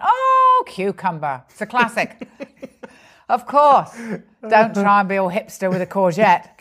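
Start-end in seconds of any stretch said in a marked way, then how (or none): 0.0-1.4 "Oh" leaps high, then "cucumber" drops right down; a bright flash of discovery; sudden eureka. Retold or imitated. Recalling finding the right vegetable, a sudden delighted realisation. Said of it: "and I suddenly went".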